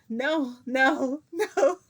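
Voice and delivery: high, loud acted-out cries of no; escalating refusal. Retelling how the refusal turned into shouting, escalating refusal. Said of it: "So it turned into".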